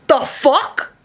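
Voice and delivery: ordinary talk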